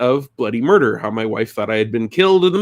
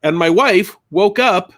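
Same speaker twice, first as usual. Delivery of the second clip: ordinary talk, nothing marked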